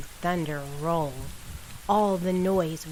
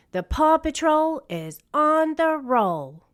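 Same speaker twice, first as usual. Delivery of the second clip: rallying call; 9 semitones higher, 5 dB louder than usual. Acted. Ryder rallies the team.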